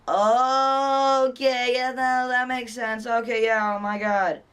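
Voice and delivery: high, loud drawn-out "okay"; sudden realization. Retold or imitated. Acted reaction on learning the mistake.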